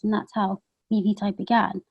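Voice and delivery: ordinary delivery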